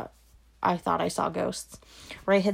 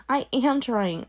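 higher pleading cry, stress on am; frustrated wail; 6 semitones higher, 3 dB louder, slower than usual. Acted. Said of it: "Tori's voice rose in a wail of frustration".

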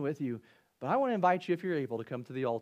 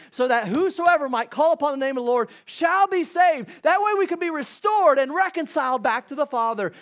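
rising, loud, fervent preacher's cry; preaching at full force; 8 semitones higher, 9 dB louder than usual. Acted. Pastor urging the congregation to follow the Savior, preaching loud and high. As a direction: build it up and shout the gospel to the church.

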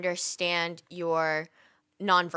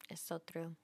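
whisper; 3 semitones lower, 19 dB quieter than usual. Real, not acted. Whispered agreement.

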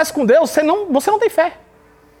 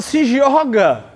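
voice of God; much slower than usual. Acted. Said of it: "Deus tá falando".

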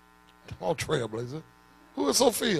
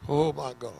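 normal talking voice

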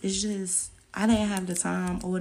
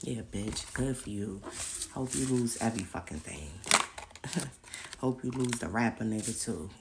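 gloating haters; 6 semitones lower than usual. Retold or imitated. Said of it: "They was happy".

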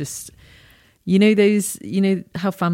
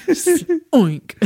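real laughter through the words; breaking up with laughter mid-word; laughing; 10 semitones higher, 4 dB louder than usual. Real, not acted. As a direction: giggle it out helplessly. Laughing while retelling the joke.

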